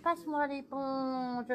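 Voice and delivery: slow, drawn-out voice from beyond; dreamy medium relaying a voice, slowly; slow relayed voice. Retold or imitated. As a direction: stretch it out like a message from far away.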